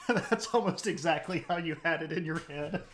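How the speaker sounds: high, loud, talking through laughter; real laughter bubbling through the words; laughing. Real, not acted. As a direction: barely get it out while cracking up. Cracking up after own impression.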